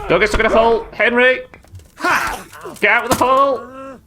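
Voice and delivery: high, loud scolding shout; exasperated yelling. Real, not acted. Player shouting at his stuck hero, high exasperated yell. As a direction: yell orders at a hapless character.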